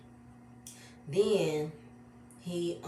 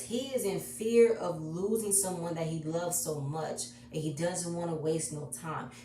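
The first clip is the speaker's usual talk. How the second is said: normal talking voice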